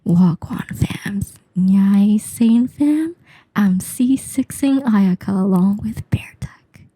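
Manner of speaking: slow breathy ASMR whisper. Pretend. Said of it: "Okay, here we go".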